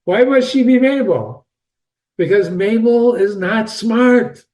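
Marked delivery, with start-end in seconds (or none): none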